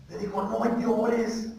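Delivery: very soft quoted consolation; soft, gently quoted words; hushed repeat. Retold or imitated. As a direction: repeat it quietly, letting it sink in. Repeats Jesus' words after quiet question.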